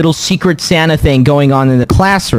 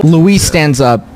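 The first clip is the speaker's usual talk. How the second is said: normal talking voice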